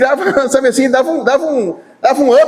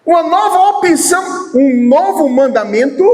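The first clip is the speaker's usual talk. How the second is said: high, shouted mock line; 6 semitones higher than usual. Shown as a demonstration. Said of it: "Foi isso que ele falou?". (grito zombeteiro)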